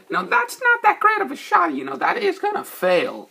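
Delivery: heckling a pool shot in a high teasing voice; trolling taunt. Retold or imitated. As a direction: needle the pool player. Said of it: "Just trolling them".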